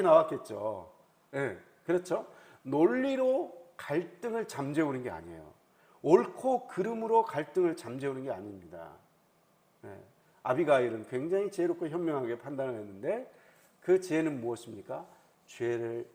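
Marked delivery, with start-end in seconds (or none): none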